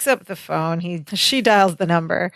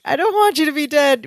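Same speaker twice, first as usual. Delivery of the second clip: high-pitched imitated tearful plea; mock sobbing; 7 semitones higher than usual. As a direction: beg like a sobbing character, hammed up. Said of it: "He's sobbing".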